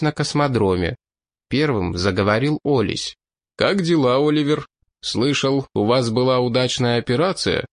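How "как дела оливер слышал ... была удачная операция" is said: ordinary delivery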